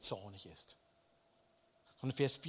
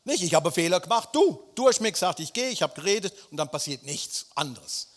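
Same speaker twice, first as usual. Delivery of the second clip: loud, indignant rant; angry complaint; 3 semitones higher, 10 dB louder than usual. Acted. Jona accusing God, loud and indignant. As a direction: throw the blame at God, loud.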